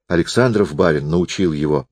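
ordinary delivery, nothing marked

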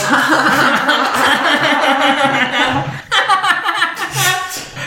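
long high cackle; laughing. Real, not acted. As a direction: burst into helpless high laughter. Listeners crack up.

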